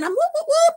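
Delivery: high excited whoops; retold cheering whoops. Teen at the concert cheering for [name], high whoops.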